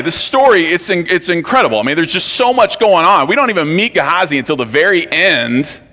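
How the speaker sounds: usual speaking voice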